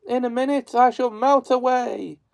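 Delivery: high, panicked witch wail; melting witch. A narrator's reading. Melting witch warns Dorothy, high and wailing. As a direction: cry it out, a witch doomed.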